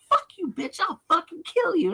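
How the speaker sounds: high, heated comeback in a staged quarrel; mock threatening yell. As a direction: yell back in a pretend bar fight. Friend's reply in mock fight.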